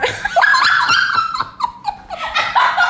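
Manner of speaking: very high shrieking laughter; crowd laughter. Real, not acted. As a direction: howl with laughter. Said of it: "no entiendo de qué se ríen".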